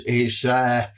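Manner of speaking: normal talking voice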